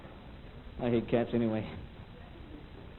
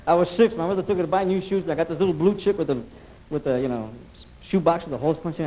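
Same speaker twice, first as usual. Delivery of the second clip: ordinary talk, nothing marked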